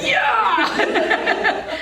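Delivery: high, loud, gleeful mock yell; playfully exaggerated excited shout; excited dog imitation. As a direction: burst out like an overeager dog. Imitating eager dogs in class.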